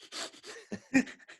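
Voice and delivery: a sudden high laugh before the punchline; burst of laughter. Real, not acted. Laughing at telling saint off.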